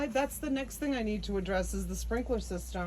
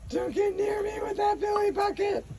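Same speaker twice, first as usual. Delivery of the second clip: high, loud shriek of disgust; squealing don't get near me; 12 semitones higher, 5 dB louder than usual. Real, not acted. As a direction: keep the dirty bucket away. Mother fending off filthy bucket.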